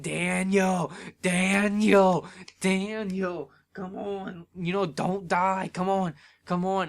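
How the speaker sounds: pleading fan yell, drawn out; retold yelling. On the phone with a friend, yelling at the match. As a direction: re-enact yelling at the wrestler to hold on. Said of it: "I'm just yelling".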